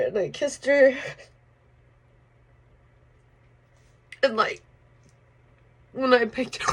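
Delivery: fighting back crying while telling it; voice breaking. Real, not acted. Recalling holding her dead mother.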